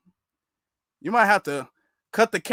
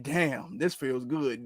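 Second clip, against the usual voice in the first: soft, lower, sighing delivery; mock relieved sigh; 7 semitones lower, 9 dB quieter, much slower than usual. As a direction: breathe it out in lazy relief. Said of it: "just the way he said".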